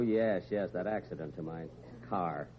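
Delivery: ordinary delivery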